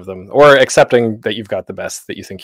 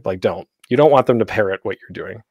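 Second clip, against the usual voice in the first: normal talking voice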